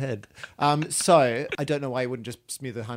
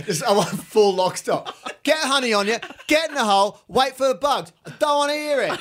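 higher, louder bossy pharaoh commands; exaggerated cartoon intonation; 10 semitones higher, 6 dB louder than usual. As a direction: bark orders like a cartoon tyrant. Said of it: "the English pharaohs now".

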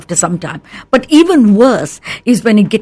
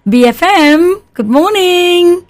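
higher, drawn-out singsong greeting; audible smile; 8 semitones higher, slower than usual. Shown as a demonstration. Receptionist greeting a caller to BFM, audibly smiling. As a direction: answer the phone with a big smile in the voice. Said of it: "you can hear it in the voice".

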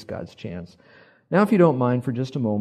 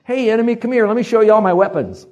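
loud, high show-off call; mock boasting; 10 semitones higher, 10 dB louder than usual. King shows off to enemy envoys, loud mock boast. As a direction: hail them with foolish pride.

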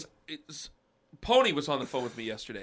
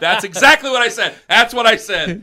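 laughing admission; 7 semitones higher, 11 dB louder than usual. Real, not acted. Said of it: "laughing admission".

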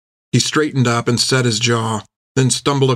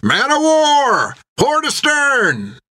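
shouting warning; much slower than usual. A narrator's reading. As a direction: yell it across the deck. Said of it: "Then Topper began shouting".

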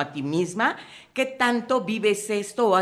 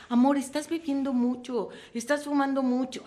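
higher gently nagging partner; mild nagging worry; 6 semitones higher than usual. Retold or imitated. Speaker voicing a partner who complains about drinking, mildly nagging. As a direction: point out the drinking, a touch reproachful. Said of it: "se irrita si le dices".

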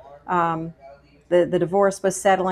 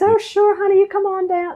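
much higher, sugary, welcoming lady; sweet as sugar; 13 semitones higher, 5 dB louder than usual. Retold or imitated. Friendly church lady inviting the newcomer, sugary sweet. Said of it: "She goes".

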